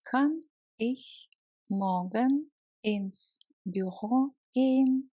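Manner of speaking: ordinary delivery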